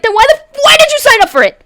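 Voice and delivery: high, fast, loud mock outburst; acted screech of fed-up irritation; exasperated yell. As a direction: yell it in hammed-up disbelief. Acted exasperated retort to bored classmates.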